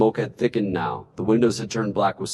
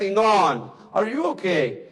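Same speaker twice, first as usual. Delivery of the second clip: panicked; 8 semitones higher than usual. A narrator's reading. Wife panicking on phone.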